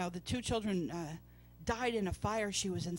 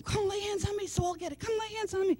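eager pleading; 10 semitones higher than usual. Retold or imitated. People begging to be touched.

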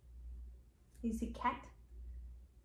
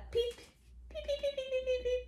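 tiny, very high soft peeps; rapid run of little high cheeps; chick peeps; 9 semitones higher, 7 dB quieter, faster than usual. Acted. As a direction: peep like a chick. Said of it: "Like a chicken".

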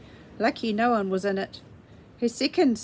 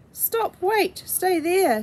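urgent shout; 8 semitones higher, slower than usual. A narrator's reading. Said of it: "said Farmer Scrubbit".

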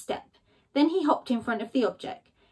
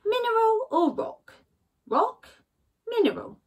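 higher, wondering Badger voice; puzzled Badger; 11 semitones higher than usual. Badger musing alone over a rock, higher. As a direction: turn it over and over aloud, musing.

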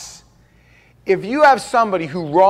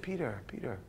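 gentle Lord; 4 semitones lower, 16 dB quieter than usual. Retold or imitated.

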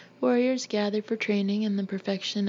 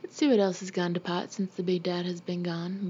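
ordinary delivery

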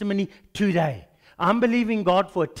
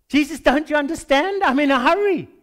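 hurried, exasperated pleading, higher and louder; impatient protest; 5 semitones higher, 4 dB louder than usual. Retold or imitated. Preacher arguing with Jesus, impatient and exasperated. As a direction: whine that you have no time.

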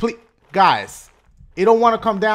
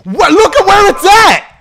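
high, loud frantic shouting; frantic yelling; 8 semitones higher, 7 dB louder, slower than usual. Real, not acted. Gamer yelling that the level is out to get him. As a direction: yell at the game in panic.

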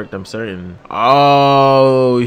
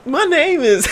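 much higher cocky mock intro; imitating the character; 13 semitones higher, 4 dB quieter than usual. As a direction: brag your name like you own the room.

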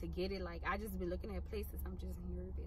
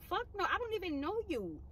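sharp, much higher-pitched brush-off; indignant retort; 11 semitones higher than usual. Pretend. Answering a pushy stranger, indignant and high. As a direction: shut the stranger down in disbelief.

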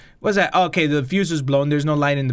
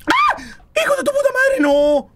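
very high shrieked curse; terrified scream; 4 dB louder than usual. Real, not acted. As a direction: scream the curse in sheer fright. Jump scare, cursing.

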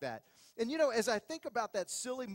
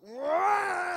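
startled yell; 10 semitones higher, 9 dB louder than usual. Retold or imitated. Bike suddenly takes off.

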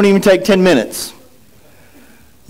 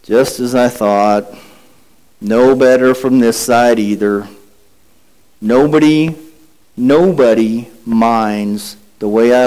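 gloomy Eeyore; 11 semitones lower than usual. Acted. Preacher reading Eeyore to the congregation, mopey low drone. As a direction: sigh it out like a glum old donkey. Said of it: "and said these words".

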